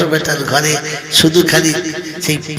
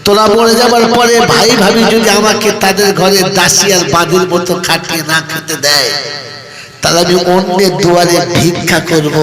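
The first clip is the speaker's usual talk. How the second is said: much higher, louder fiery sermon cry; impassioned preaching; 4 semitones higher, 5 dB louder than usual. Acted. Preacher cries the daughter's vow out to listeners, impassioned. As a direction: lift the voice and cry it out to the crowd.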